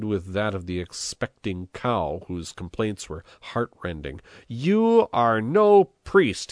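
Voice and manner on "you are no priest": high, drawn-out angry shout; vociferating. A narrator's reading. Mayor rounding on the priest, vociferating. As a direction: shout it slowly at a high pitch, outraged. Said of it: "he vociferated".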